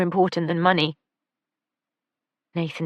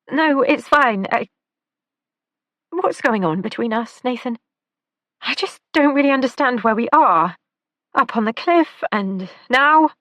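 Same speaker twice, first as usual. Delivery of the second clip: higher, louder, flustered questioning; flustered young woman; 8 semitones higher, 6 dB louder than usual. Acted. Lila asks Nathan where they stand, flustered and raised. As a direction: Lila pressing him, rattled and confused.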